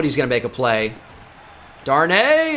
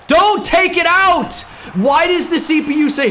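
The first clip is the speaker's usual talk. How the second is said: much higher, loud slowed protest; frustrated yell; 13 semitones higher, 4 dB louder than usual. Real, not acted. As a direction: shout at the game to stop. Yelling at the game's CPU.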